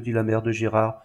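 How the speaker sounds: ordinary delivery, nothing marked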